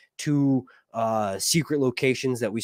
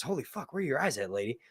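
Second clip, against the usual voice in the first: hushed shock; 10 dB quieter, faster than usual. Retold or imitated. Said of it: "our reaction, which is".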